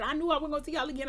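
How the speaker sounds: fast, high-pitched protest; mildly angry, hurried reenactment; retold mad outburst. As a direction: rush it out, going off on them. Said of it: "I was going off. I was mad".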